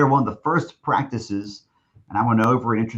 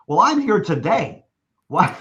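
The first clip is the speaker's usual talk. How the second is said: mock insistent; 8 semitones higher, 4 dB louder than usual. Coach insisting, retold.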